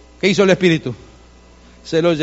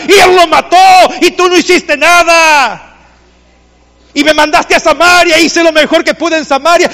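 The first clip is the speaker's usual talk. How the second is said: high, loud protest building to a shout; indignant complaint; 10 semitones higher, 8 dB louder than usual. Acted. Felipe protesting to God about his assignment, indignant. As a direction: plead your grievance loudly, pitched high.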